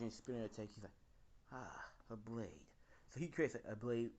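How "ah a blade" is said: quiet realization. Retold or imitated. Deku thinking of an idea.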